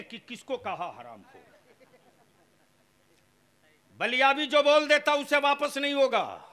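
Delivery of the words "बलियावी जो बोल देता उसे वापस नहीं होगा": loud, high shouted challenge to the crowd; full-force preaching. Real, not acted. Preacher daring a huge gathering to answer, full-force shout. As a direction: thunder at the crowd, demanding an answer.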